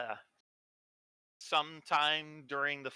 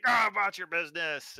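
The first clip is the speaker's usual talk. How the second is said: loud, raised command to the crowd; guard shouting; 5 semitones higher, 7 dB louder than usual. Acted. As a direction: bark it out like a city guard.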